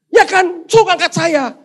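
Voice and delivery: high, loud indignant question; exasperated yelling. Acted. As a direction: lay into the useless helper. Man argues with angel.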